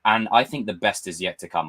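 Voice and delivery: normal talking voice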